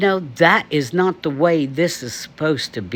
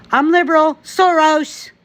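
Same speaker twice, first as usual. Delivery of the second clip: much higher, sneering mock voice of a liberal; mocking quote; 13 semitones higher than usual. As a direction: squeak it out like a mocking caricature. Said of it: "You might as well say".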